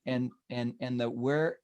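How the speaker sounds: usual speaking voice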